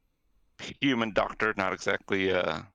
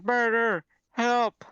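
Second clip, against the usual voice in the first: very high cry for help, barely louder; mock shouting; 13 semitones higher, 3 dB louder, much slower than usual. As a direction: call out in panic without full volume. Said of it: "she's shouting loudly into the street".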